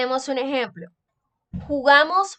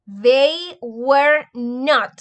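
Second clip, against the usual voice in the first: slow teaching read; 3 dB louder, much slower than usual. Shown as a demonstration. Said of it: "very slow model sentence".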